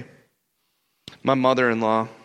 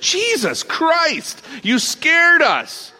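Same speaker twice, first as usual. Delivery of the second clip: high, loud, drawn-out cry of fright; scared-silly exclamation; startled yell; 7 dB louder, much slower than usual. Acted. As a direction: shout it slowly like you got a huge scare. Acted fright at seeing the ghost.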